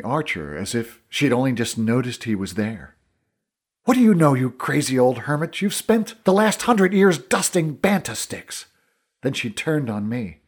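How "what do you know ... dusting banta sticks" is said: high, loud, scornful outburst; scornful anger. Acted. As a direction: lash out at the old man, rising in pitch. Essa turns on the old archer.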